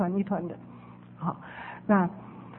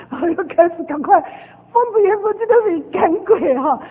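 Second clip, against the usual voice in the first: frantic excitement; 6 semitones higher, 6 dB louder than usual. Retold or imitated. Frantic exorcism retold.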